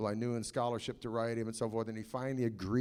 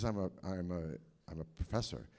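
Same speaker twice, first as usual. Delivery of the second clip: timid professor; 6 semitones lower, 6 dB quieter than usual. Retold or imitated. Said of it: "he says".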